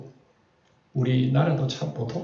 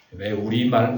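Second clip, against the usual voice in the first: normal talking voice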